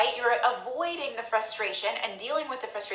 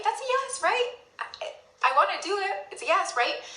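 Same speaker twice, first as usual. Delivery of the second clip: mock eager yes; 9 semitones higher than usual. Self-deceiving shopper insisting it is a yes, squeaky high. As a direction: squeak it to convince yourself. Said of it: "this feels like a yes".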